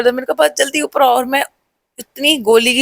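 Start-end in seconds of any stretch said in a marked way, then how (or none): 0.4-1.1 higher, pressing urgency; telling her sister to come up, urgent and higher; urgent hurry. Retold or imitated. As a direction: hurry her upstairs, no time to explain. Urging sister upstairs in panic.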